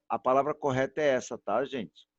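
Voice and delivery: ordinary talk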